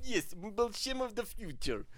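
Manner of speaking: put-on Russian accent. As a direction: answer firmly in a mock Russian accent. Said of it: "do the accent for Alexei".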